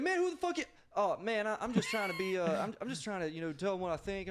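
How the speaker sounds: high, worked-up mock protest; hammed-up flustered troublemaker; imitated agitated protest. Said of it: "this dude starts screaming".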